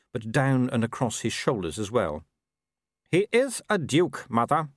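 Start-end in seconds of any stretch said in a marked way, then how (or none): 3.1-4.7 lightly voiced young werewolf noble, cool; a hint of the arrogant son; Wulf's voice. A narrator's reading. Son answering his mother, lightly voiced young noble.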